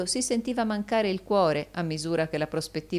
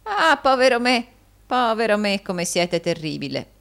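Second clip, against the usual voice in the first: loud high lament, easing down; hammed-up "poor me"; wheedling old usurer in mock dismay; 6 semitones higher, 8 dB louder than usual. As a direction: wail theatrically, then let it settle. Gride exclaims in mock dismay.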